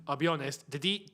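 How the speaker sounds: usual speaking voice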